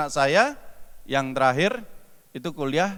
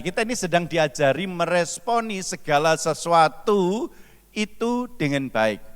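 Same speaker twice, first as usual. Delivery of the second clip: ordinary talk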